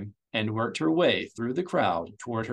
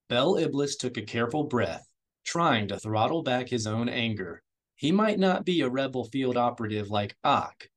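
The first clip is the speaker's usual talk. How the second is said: usual speaking voice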